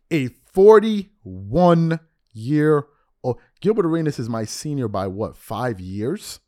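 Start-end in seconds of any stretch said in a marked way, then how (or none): 0.1-3.4 slow, loud, stretched-out disbelief; incredulous emphasis. Real, not acted. Host mocking Gilbert's age, slow and loud. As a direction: lean hard on every syllable.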